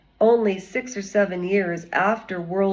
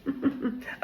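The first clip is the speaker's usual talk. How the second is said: laugh; 5 semitones higher, 8 dB quieter than usual. Real, not acted. Laughs after singing.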